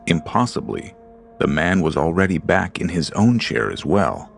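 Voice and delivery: ordinary delivery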